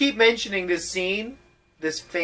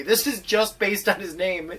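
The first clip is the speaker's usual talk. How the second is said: usual speaking voice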